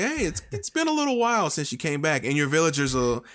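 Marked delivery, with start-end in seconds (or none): 0.0-2.2 higher-pitched friendly game villager quote; villager greeting. Retold or imitated. Villager reminding a player who has been away, lightly imitated. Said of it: "they do say".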